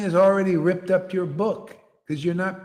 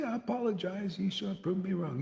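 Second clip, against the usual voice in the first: mock apology; 2 semitones higher, 11 dB quieter than usual. Preacher pretends to concede to rival gurus, soft apology. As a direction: act out backing down in a small voice.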